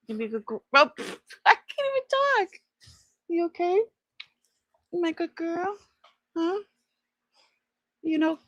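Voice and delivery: high, sing-song doggy talk; pet-talk voice to a beloved dog. Real, not acted. Baby talk to her dog.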